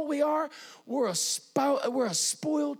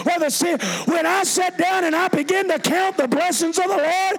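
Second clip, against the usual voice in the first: high, loud shouted praise; preaching at full force; 4 semitones higher, 6 dB louder than usual. Real, not acted. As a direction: shout it out to rouse the congregation.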